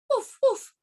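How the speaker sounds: high little dog woofs; dog barking. Acted. Story's dog answers.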